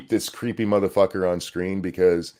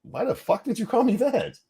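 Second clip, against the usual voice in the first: high-pitched, incredulous squawk; mock indignant disbelief; 13 semitones higher than usual. Husband reacting to his wife using that name, mock indignant. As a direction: act baffled at being called that, voice way up. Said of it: "I'd be like".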